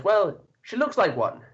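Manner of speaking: high, silly villager answer; peasant crowd voice. Acted. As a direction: pipe up like a dim peasant. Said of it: "And the crowd replies".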